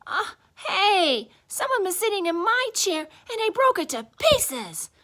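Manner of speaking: high, loud, tearful little bear; Baby Bear. A narrator's reading. Baby Bear with his chair in pieces, high tearful wail. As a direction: wail it like a child close to tears. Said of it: "Baby Bear looked at his".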